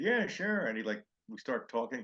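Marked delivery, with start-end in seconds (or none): 0.0-0.7 much higher, louder easy agreement; cheerful stranger saying yes; mock friendly trucker; imitating the pickup guy. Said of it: "And the guy said".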